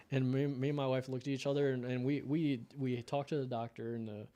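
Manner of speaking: ordinary talk, nothing marked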